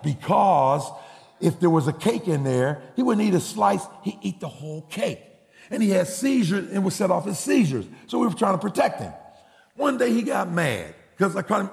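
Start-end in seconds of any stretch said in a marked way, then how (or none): none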